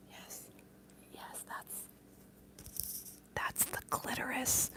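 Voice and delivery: secretive whisper, nearly silent. Real, not acted. Streamer telling a viewer something risqué, conspiratorial whisper. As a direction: breathe it like a naughty secret.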